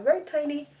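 high, playful little voice for "tiny little stack"; cutesy tiny voice. Acted. Vlogger to viewers about her tiny book stack: high cutesy voice.

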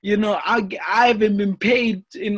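high, slow, exasperated retold outburst; fed-up boxer reliving a third pullout, loud and exasperated; frustrated outburst. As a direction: let the frustration burst out, drawn out and high. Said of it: "Now I'm getting frustrated".

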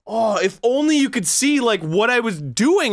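high-pitched indignant complaint; exasperated protest. Real, not acted. Player defending himself to co-host, exasperated protest. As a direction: plead your case, worked up.

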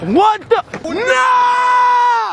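high dismayed cry; shocked shout. Real, not acted.

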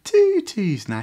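high, loud "Tutus now"; loud catchphrase. Acted. Repeated story catchphrase.

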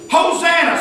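high-pitched crowd holler. Acted. Crowd member welcoming Jesus into town, hollering. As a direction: holler it out in acclaim. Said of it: "Some of them would probably holler that".

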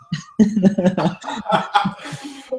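long high laugh. Real, not acted. Cracking up at the old pitch story, high laugh. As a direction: burst into high, rising laughter.

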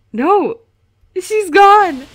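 high, loud cry of alarm; sudden high shout of alarm; shocked cry. Real, not acted. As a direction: shout as the character disappears. Character vanishes on screen.

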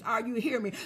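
ordinary delivery

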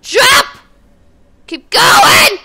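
high, full-volume gamer yell; random screaming. Real, not acted. Player screaming commands at the game, loud high shout. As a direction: yell it out in short panicked bursts. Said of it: "I scream randomly sometimes".

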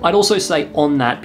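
normal talking voice

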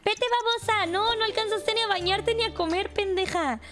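high-pitched, hurried mock scolding; urgent scolding. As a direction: nag her in a playful panic. Scolding her character for lateness.

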